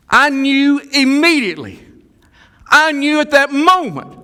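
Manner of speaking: high, loud, ringing declaration; preaching shout. Real, not acted. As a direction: proclaim it at full force. Preacher building to a climax.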